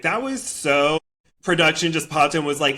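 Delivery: usual speaking voice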